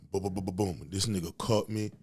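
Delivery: ordinary talk, nothing marked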